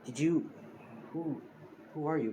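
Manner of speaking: lightly acted confusion on waking, in broken starts; groggy waking up. Injured brother waking up to a stranger, groggy and halting. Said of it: "he's pretty much waking up saying".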